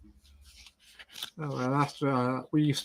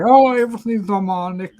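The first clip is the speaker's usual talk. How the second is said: imitating alarmists, louder and higher; hammed-up cry of over-eager believers; mock alarm; 6 semitones higher, 8 dB louder than usual. Mimicking people who blame demons.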